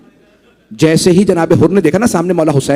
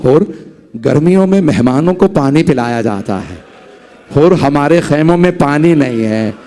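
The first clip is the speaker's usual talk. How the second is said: smiling reply; 7 semitones lower than usual. A narrator's reading. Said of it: "मौला ने मुस्कुरा के कहा".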